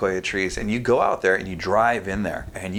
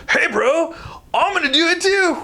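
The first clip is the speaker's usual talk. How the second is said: much higher, louder surfer-bro voice; dude voice; 5 dB louder than usual. Retold or imitated. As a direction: play a pumped-up stranger yelling he's joining in. Random guy voiced in story.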